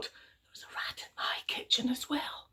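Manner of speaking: hushed secret whisper. Acted. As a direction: lean in and whisper it. Friend confides the same news.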